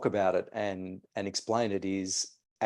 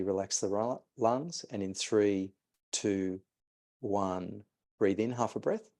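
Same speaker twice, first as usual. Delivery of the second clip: guided breathing instruction. Acted. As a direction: talk the listener gently through the exercise. Leads a fire-breath exercise.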